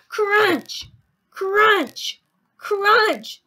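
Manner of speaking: loud, high, separate chomps; emphatic crunching. A narrator's reading. Teacher reading as Sammy bites everything, punchy.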